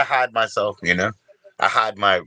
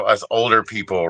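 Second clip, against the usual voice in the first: ordinary talk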